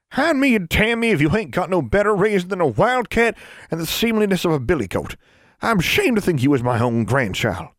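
put-on high old granny calling out a child's full name; stern old granny. A narrator's reading. Great-grandmother catching the snooping girl, high stern granny voice.

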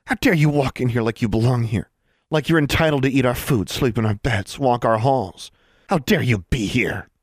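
angry snarl. A narrator's reading. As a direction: snarl accusations at an intruder. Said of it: "snarled the blonde girl".

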